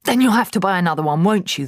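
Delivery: mock her while tearing pages; sneering father. A narrator's reading. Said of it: "the father said".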